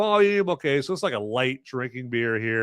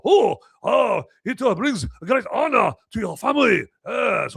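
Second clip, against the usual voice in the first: mock Japanese accent; 8 semitones higher, 4 dB louder than usual. Mock Japanese honor phrase.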